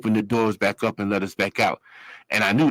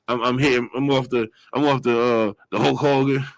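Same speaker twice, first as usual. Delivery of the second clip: ordinary delivery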